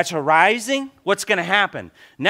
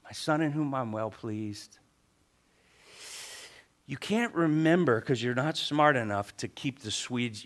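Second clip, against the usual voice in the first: low, hushed voice of God; gentle drop to a quiet, lower voice; still quiet voice; 4 semitones lower, 5 dB quieter than usual. Acted. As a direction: speak softly as the Lord to a son. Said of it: "that still quiet voice said".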